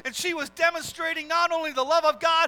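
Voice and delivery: preacher building to the message of forgiveness; preaching climax. Acted. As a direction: drive the point home from the pulpit.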